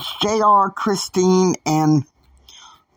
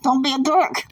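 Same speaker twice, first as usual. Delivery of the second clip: a sharp, high mock voice for Christine; 11 semitones higher than usual. Teller mimics Christine scolding J R, in a high mock voice. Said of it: "Basically, Christine's like".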